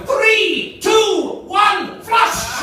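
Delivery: high, loud, excited count; Scottish commentator countdown. Acted. Scottish commentator counting down to the toilet flush, hammed-up excited shout. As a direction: shout each number like a launch. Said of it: "the Scottish commentator will kick in and go".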